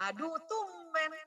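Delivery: sneering sarcasm, slow and high; sarcastic. Shown as a demonstration. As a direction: drawl it with mock surprise.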